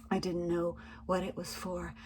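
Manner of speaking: ordinary delivery, nothing marked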